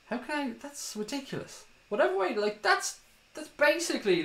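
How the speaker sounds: squeaky outraged disbelief; exasperated. Real, not acted. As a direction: voice jumps high in disbelief. Ranting in disbelief at the deal.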